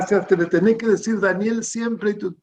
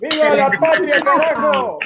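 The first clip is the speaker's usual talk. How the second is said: shouted patriotic cry, much higher; booming balcony speech; 8 semitones higher, 5 dB louder than usual. Real, not acted. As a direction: bellow it to the crowd. Patriotic cheers at farewell. (grito patriótico enérgico)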